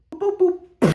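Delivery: high beeping noise. Acted. Computer beeping.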